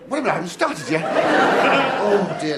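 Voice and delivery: out of breath. Pretend. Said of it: "I'm sorry about this panting".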